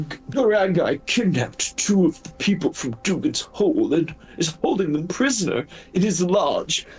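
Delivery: high, quick, whimpering wolf voice; a frightened injured wolf pleading; timid beast in a high voice; pitiful wolf. Acted. Wolf speaking.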